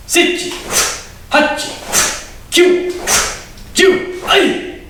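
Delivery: rising shouted count to a kiai; dojo count. Shown as a demonstration. Sensei counting the class through punches, rising call. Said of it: "Chokozuki punch on my count".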